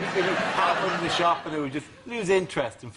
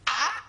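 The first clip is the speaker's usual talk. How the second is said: a short high-pitched yelp; mock frog scream; 11 semitones higher than usual. Comedian imitating a cat's frog victim, a high yelp. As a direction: squeal like a frightened frog. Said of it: "which is the sound of a distressed frog".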